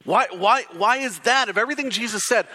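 usual speaking voice

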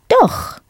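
"Doch" pitched high, then falling sharply; emphatic doch. Shown as a demonstration. Teacher models the answer to a negative question, emphatic. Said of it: "that emphatic doch answer".

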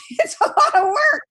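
high, loud squeal through laughter; exasperated squeal. Real, not acted. Comic exasperation at workload.

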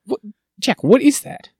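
high-pitched comic disbelief; mock-outraged, rising questioning; incredulous demand. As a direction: demand an explanation, voice shooting up. Mock outrage put to co-host.

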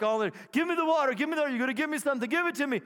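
high, fast, eager demand; a woman demanding what's promised. Retold or imitated. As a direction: grab for the water greedily. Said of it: "She's like".

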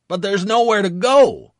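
loud, high shouted agreement; shouting. Acted. Corporal shouts under fire.

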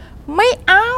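high, drawn-out cry of refusal; retold shout. Dreamer gathering all strength to refuse, shouting. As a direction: cry it out high, stretching the words. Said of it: "ตะโกนบอก".

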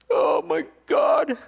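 loud, high, slow cry of amazement; excited outburst. Real, not acted. Preacher overwhelmed by the dying man's joy, crying out. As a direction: let it burst out of you.

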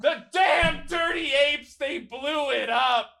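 high, slow, hammed-up shout of despair; mock movie shout. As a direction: bellow it like the Planet of the Apes hero. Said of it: "I said".

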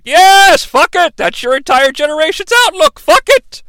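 high, loud mock outburst; hammed-up scolding, rising to a yelp; exasperated rant. Lebowski scolds the guy, high exasperated shout. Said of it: "And he's like".

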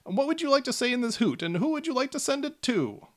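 high eager gnome salesman; high, keen gnome sales question. Acted. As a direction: chirp it up like a pushy gnome. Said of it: "and says".

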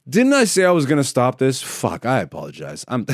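ordinary delivery

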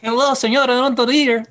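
high, loud cartoon Mexican accent; hammed-up Speedy Gonzalez Mexican accent. Shown as a demonstration. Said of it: "a Speedy Gonzalez kind of voice".

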